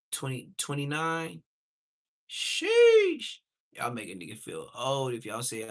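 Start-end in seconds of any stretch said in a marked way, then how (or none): 2.3-3.4 sharp high loud exclamation; high sheesh. Real, not acted. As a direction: shoot it up high in disbelief. Exclaiming at young ages.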